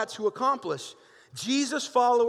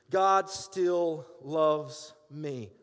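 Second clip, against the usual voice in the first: lower, very slow, every word weighed; slow reassurance; 5 semitones lower, much slower than usual. Acted. Pastor affirms God's love despite his flaws, slow and tender. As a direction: let it land as a promise.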